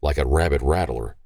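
ordinary delivery, nothing marked

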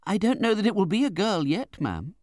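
lightly voiced naive young woman, high; innocent reply. A narrator's reading. Young widow misunderstands the aunt, innocent. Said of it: "said my mother innocently".

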